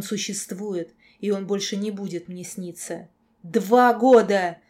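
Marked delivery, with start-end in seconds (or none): 3.4-4.6 very loud, slow, drawn-out shout; anguished yell. A narrator's reading. Girl yelling at her nightmare through tears, anguished yell. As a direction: scream it, stretching both words. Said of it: "заорала я".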